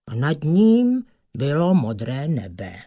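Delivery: usual speaking voice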